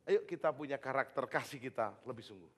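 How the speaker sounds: ordinary delivery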